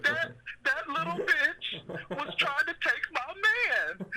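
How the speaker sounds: high-pitched mock Monique voice, slowing at the end; imitating Monique's character. Friend retelling the movie scene, mimicking Monique high and slow. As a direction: ham up the abusive mother's confession, high and drawn out. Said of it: "And Monique is like".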